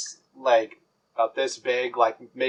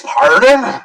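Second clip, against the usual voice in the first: louder, offended pardon; loud indignant pardon; 6 semitones higher, 7 dB louder than usual. Acted. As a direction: demand it back, affronted. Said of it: "I says".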